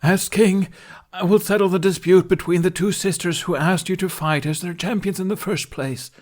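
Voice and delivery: high, loud storybook proclamation; loud stage narration. A narrator's reading. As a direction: drown them out with grand narration. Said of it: "strained smile".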